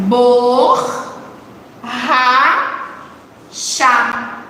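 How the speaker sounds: "Bor, ra, chá" as separate drawn-out syllables. Shown as a demonstration. Teacher counting syllables with the class, "Bor, ra, chá" said piece by piece. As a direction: count out "Bor, ra, chá" with a clear pause after each. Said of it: "como fica a separação".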